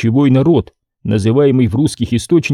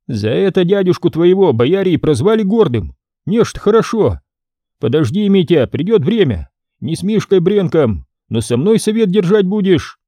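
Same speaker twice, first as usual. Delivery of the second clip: put-on condescending young boyar; 7 semitones higher than usual. Ivan lectures prince.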